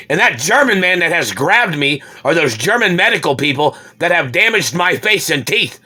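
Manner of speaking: loud, high, furious rant; furious shout. Real, not acted. Ranting at the Germans who hurt him, furious shouting. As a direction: yell the accusations, rising in pitch.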